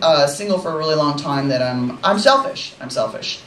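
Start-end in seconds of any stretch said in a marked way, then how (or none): 2.8-3.4 hushed confession; whispered aside. Acted. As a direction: whisper "I'm selfish" under breath.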